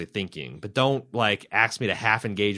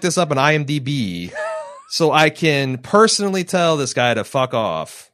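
mock indignation; 4 semitones higher, 7 dB louder than usual. Joking about confronting the producer.

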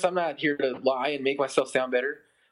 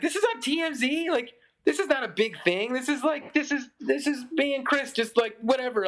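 high, incredulous retelling of his own reaction; playing his past self, baffled and much higher; 8 semitones higher than usual. He finds his story on TMZ, in high incredulous disbelief. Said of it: "I was like".

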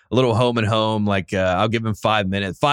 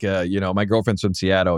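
usual speaking voice

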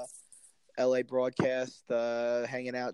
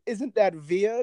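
movie quote; 7 semitones higher, 6 dB louder, slower than usual. Retold or imitated. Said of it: "it's from a movie".

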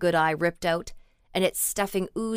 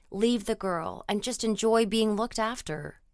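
usual speaking voice

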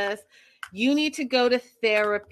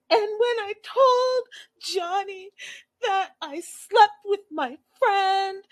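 high, slow, whiny fake sobbing; mock crying whine; 12 semitones higher, 3 dB louder, slower than usual. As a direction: mimic her teary whining, hammed up. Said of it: "When she started crying and really whining".

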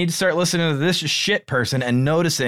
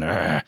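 low, soft growled "ugh"; imitated low groan; imitated disapproving grunt; 8 semitones lower, 4 dB quieter than usual. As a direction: mimic the boss's low grumble of disgust. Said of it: "you just see him going".

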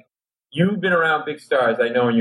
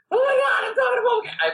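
inner freak-out; faster than usual. Retold or imitated. Inner voice meeting [name].

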